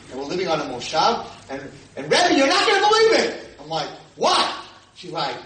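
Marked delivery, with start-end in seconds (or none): 2.1-3.5 loud, high excited burst; excited exclamation. Retold or imitated. Former student shares news with her rabbi, excited. 4.2-4.5 high, loud startled yelp; startled reaction. Retold or imitated. Rabbi reacts to his student's news, startled.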